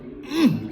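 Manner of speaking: high, straining grunt through a closed mouth; strained holding-in grunt. Retold or imitated. Patient showing how he held in pain, strained grunt. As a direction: imitate stifling a yell of pain. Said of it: "saya itu ngempet begitu ya".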